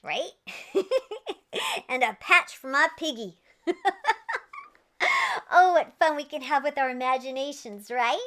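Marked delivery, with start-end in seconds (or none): none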